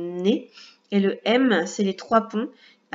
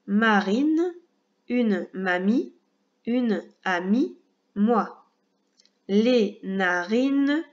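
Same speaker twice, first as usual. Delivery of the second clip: mots lus lentement, bien articulés, un à un; lecture modèle de mots; much slower than usual. Shown as a demonstration. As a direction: lis chaque mot comme un modèle pour l'élève. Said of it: "maintenant c'est à mon tour". (lecture lente et appliquée des mots, pour un enfant)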